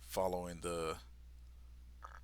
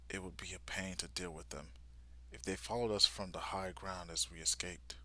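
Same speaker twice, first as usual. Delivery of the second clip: much softer, fast whisper; whispered thought; 3 semitones lower, 7 dB quieter, faster than usual. Retold or imitated. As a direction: whisper the plan to yourself. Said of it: "thinking to himself".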